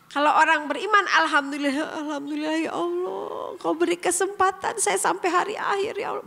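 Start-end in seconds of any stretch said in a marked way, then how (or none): 1.2-6.3 mild tearful gratitude, voice catching; tearful prayer. Retold or imitated. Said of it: "Bari nangis".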